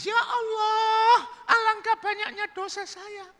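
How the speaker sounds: high falsetto mock weeping; crying woman. Woman crying over her sins, mock weeping. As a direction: wail repentantly in a high falsetto. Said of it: "wanita pelacur itu menangis".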